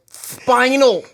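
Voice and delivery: high, lisping Tyson voice; Mike Tyson impression. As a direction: do a squeaky Tyson lisp.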